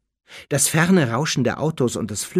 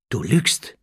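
usual speaking voice

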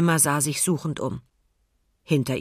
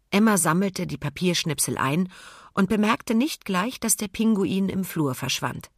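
usual speaking voice

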